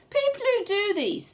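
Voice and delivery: ordinary delivery, nothing marked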